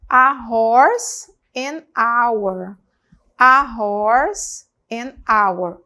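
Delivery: loud model contrast; contrast h sounds. Shown as a demonstration. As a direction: contrast the h in "A horse," and the silent h in "an hour.". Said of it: "Olha aqui o som de R".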